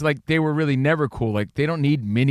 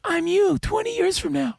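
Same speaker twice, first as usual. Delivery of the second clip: spooky. Retold or imitated. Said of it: "He goes".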